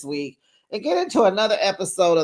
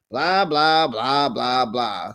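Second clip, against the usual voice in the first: bored blah blah; 4 semitones lower, slower than usual. Real, not acted. Vlogger brushing off Lisa's repeated apology, dismissive. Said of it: "dismissing Lisa's excuses".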